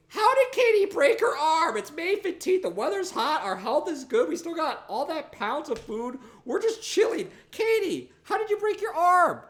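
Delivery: very high, loud squeaky questioning; high mock interrogator. Asking Katie about her broken arm, very high loud squeaky voice. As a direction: squeak at Katie like a cartoon nag.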